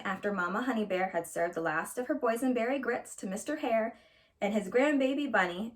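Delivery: plain reading voice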